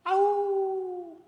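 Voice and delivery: high, loud howl; wolf howl. Acted. Storyteller leading kids in the wolf's howl, high and loud. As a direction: howl at the moon. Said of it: "The wolf ... cries to the moon".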